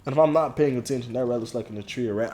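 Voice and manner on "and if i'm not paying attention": ordinary talk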